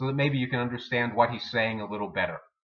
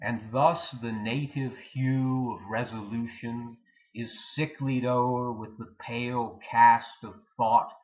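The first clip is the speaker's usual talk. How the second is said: dramatic soliloquy recitation; 2 semitones lower, slower than usual. Acted. Hamlet alone, pondering whether to be or not to be, dramatic soliloquy. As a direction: recite it as Hamlet weighing life and death.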